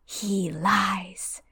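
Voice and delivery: eerie unvoiced whisper; hissing whisper of a hidden master. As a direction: breathe it like a ghostly voice. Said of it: "the high voice speaks again".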